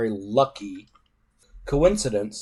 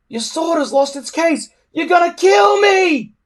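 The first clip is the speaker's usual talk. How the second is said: high, loud panicked plea, rising to a shout; 6 dB louder than usual. A narrator's reading. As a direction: scream it, terrified. Hiccup pleads in danger.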